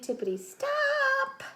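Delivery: high, loud, drawn-out stop; shocked high stop; sudden stop. A narrator's reading. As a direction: slam on the brakes with a squeal.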